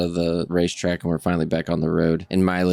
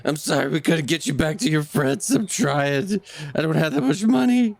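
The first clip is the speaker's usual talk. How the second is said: small creature voice; 8 semitones higher than usual. Acted. Mylan apologizes to the horse.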